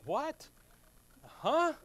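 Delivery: high, incredulous what; retold startled huh; mock disbelief. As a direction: play baffled disbelief. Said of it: "I'm like".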